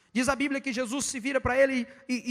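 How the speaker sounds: ordinary talk, nothing marked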